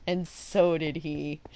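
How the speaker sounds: usual speaking voice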